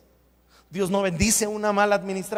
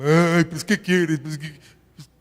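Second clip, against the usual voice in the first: put-on, hammed-up retort; mock questioning voice; 3 semitones lower, 5 dB louder than usual. Preacher joking about how the question sounds, mock voice. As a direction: act out a silly comeback. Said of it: "parece pregunta así como".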